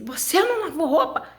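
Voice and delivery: pergunta acusadora bem aguda, com riso; mãe brava imitada, rindo. Retold or imitated. A mãe cobrando, reencenado rindo. (voz da mãe brava, com um riso)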